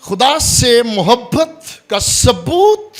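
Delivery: loud, high, slow proclamation; forceful preaching. Real, not acted. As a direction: proclaim it slowly at full force. Preacher drives home his point.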